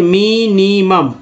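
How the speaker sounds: "Minimum" stretched as mi-ni-mum; slow syllable-by-syllable. Shown as a demonstration. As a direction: sound out "minimum" slowly, part by part. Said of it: "इसको धीरे धीरे पढ़ते हैं". ("Minimum" को तोड़कर धीरे पढ़ें)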